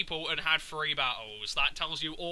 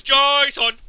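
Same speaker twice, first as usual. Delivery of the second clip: higher and much louder, hammed-up yell of "Jason!"; mock Heavy Rain lead; 7 semitones higher, 10 dB louder than usual. Mocking the Heavy Rain lead, a hammed-up yell. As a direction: bellow the name like a desperate dad. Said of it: "when the lead character goes".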